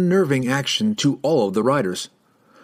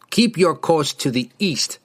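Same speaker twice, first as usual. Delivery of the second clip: higher, firm warning; stern warning; 6 semitones higher than usual. A narrator's reading. Rider orders the party eastward, warning. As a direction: warn them off firmly. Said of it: "the rider warned".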